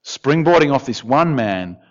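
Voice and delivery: normal talking voice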